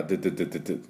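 rapid repeated ドゥ with the tongue; quick percussive ドゥ bursts; forward D sound. Shown as a demonstration. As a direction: fire off ドゥ quickly at the front of the mouth. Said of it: "しっかりドゥ".

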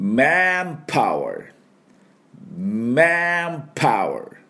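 manpower very slow, in English; teacher modelling manpower slowly to repeat; model pronunciation. Shown as a demonstration. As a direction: draw out manpower for repetition. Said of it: "私の後について発音いきましょう".

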